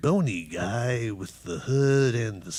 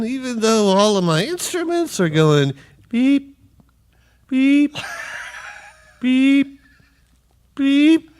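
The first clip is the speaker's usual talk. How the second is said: beeping noises; 10 semitones higher, 4 dB louder than usual. Acted. Imitates instrument beeping.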